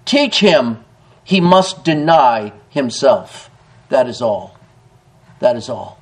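slow, low, solemn pronouncement; said slowly. A narrator's reading. General Lee advising a young mother, slowly. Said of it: "and slowly said".

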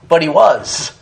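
normal talking voice

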